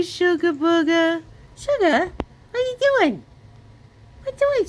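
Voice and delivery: a mama cooing to her cat. Real, not acted. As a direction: sweet-talk the cat way up high. Baby talk to the cat.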